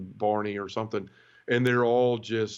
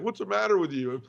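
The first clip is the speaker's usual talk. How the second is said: lightly voiced nun, pitched higher; a hint of a puzzled nun calling over; startled nun; 8 semitones higher than usual. Nun to man hitting steering wheel, lightly voiced puzzled question.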